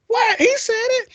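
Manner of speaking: put-on high squeaky kid voice protesting; child protest. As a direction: squeak like a scolded little boy. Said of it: "I'm like".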